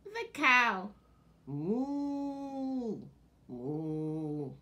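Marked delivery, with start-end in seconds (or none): 1.5-4.5 low drawn-out moo; mooing cow. Shown as a demonstration. Showing children the cow's sound, a gentle moo.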